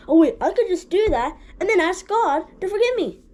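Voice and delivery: high, sly mock voice hatching a loophole; scheming sinner. Preacher voicing someone planning to sin then ask forgiveness, high and sly. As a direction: sound like you just found a clever trick. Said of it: "he's like".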